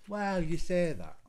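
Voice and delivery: loud, high, drawn-out doubting "well"; teasing challenge. Real, not acted. Partner disputing she misses sarcasm, teasing challenge. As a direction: cut in loudly and slowly to dispute it.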